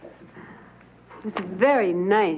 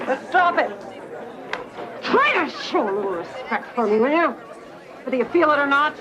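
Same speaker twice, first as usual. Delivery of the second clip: stern; 5 semitones higher than usual. Acted. Mother demands respect from daughter.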